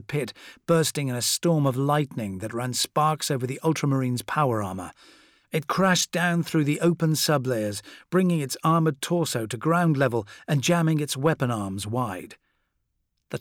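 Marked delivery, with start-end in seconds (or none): none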